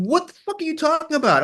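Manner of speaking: incredulous outburst. Retold or imitated. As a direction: friends at dinner, stunned and incredulous. Friends retold, incredulous reaction.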